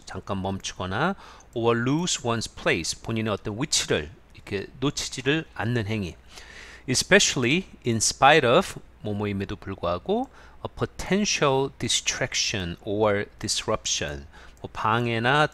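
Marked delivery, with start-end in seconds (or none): 1.5-3.0 slow reading. Shown as a demonstration. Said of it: "teacher reads English definition". 6.9-8.8 slow reading. Shown as a demonstration. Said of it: "teacher reads English definition". 10.7-14.2 each word drawn out for learners; slow reading. Shown as a demonstration. Teacher reads the definition to Korean learners, very slowly. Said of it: "teacher reads English definition".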